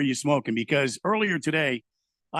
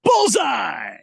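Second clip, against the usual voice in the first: announcer shout; 8 semitones higher, 5 dB louder than usual. Retold or imitated. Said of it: "they go".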